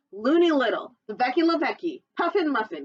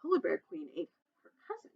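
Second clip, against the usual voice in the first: ordinary delivery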